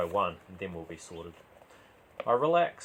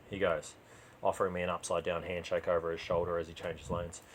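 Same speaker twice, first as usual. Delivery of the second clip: plain reading voice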